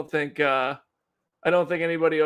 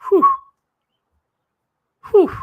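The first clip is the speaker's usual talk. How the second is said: usual speaking voice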